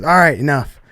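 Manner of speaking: loud, sharp "enough"; boss calling it off. Retold or imitated. The head honcho stops the fight with a loud call. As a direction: bark at them to stop fighting. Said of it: "the head honcho goes".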